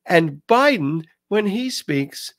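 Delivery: ordinary delivery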